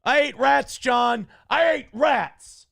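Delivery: loud, high shout of grievance; angry dog shouting. Retold or imitated. Host voicing the dog yelling at its owner. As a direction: yell it at the owner, outraged.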